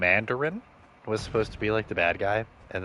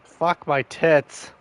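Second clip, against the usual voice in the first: frustrated curse; 8 semitones higher, 8 dB louder, much slower than usual. Real, not acted. Frustrated after dying in game.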